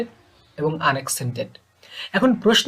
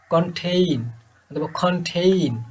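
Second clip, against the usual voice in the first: Contain with a short first syllable and a long-held ten; stress on second syllable; slower than usual. Shown as a demonstration. Teacher showing syllable length in contain, second syllable drawn out. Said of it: "একটু long করে উচ্চারণ করা".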